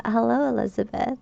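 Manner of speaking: ordinary talk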